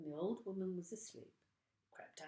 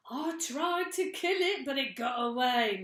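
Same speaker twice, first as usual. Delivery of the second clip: high, loud mocking old man voice; gruff old man; 7 semitones higher, 11 dB louder than usual. Mister Twit taunting his frightened wife. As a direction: tease her with glee.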